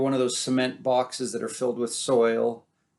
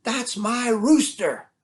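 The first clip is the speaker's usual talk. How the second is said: high, loud scolding cry at the thief; farmer's wife yelling; 11 semitones higher, 3 dB louder, slower than usual. Acted. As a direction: shout after the fox with a spoon in hand. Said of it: "and she says".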